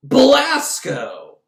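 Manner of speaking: slow, loud, high drawn-out name; hammed-up realization. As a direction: announce the villain's name with mock drama. Dramatic realization.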